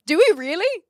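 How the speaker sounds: high, loud disbelieving "really?"; surprised. Real, not acted. Reading a startling chat fact, surprised and loud. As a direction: genuinely caught off guard by the fact.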